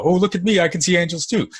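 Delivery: hammed-up bragging about seeing angels; mock ego boast. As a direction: parody a self-satisfied show-off. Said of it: "taken me into ego".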